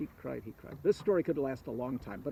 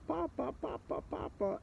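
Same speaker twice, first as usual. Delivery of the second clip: put-on high, soft little-child voices; children's mock yell; 8 semitones higher, 9 dB quieter than usual. A narrator's reading. Three-year-olds run to their new father, mock yelling. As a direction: imitate small children calling, high and hushed.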